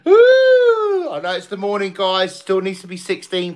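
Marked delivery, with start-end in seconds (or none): 0.0-1.1 high, loud whoop; excited whoop. Real, not acted. Streamer cheering at chat, high loud whoop.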